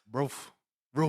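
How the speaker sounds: spoken-word dog woofs; short mock barks; imitated dog bark. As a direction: bark it as a person pretending to be a dog. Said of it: "it'd be me barking".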